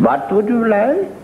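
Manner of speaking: high, slow, odd innkeeper voice; drawn-out host question with a rising end. Acted. Innkeeper asks the traveler for his order, high odd voice.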